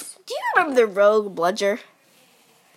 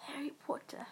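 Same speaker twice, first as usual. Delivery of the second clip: soft breathy whisper, no voice; 2 semitones higher, 22 dB quieter than usual. Real, not acted. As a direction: breathe the name out like a secret. Whispered echo of friend.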